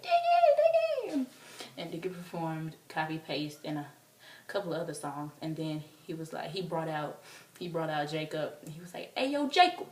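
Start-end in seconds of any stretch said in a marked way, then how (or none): 0.0-1.3 very high, loud fangirl shriek; imitated fan scream. Oblivious fan calling to Diggy, imitated scream. 9.2-9.9 loud, raised stage shout; imitated calling out. Diggy calling Jacob onstage, imitated holler.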